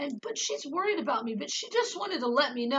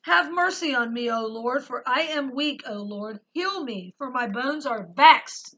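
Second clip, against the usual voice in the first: louder, earnest psalm recitation; scripture reading; 6 dB louder than usual. Acted. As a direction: read the psalm like a heartfelt prayer. Reads psalm aloud.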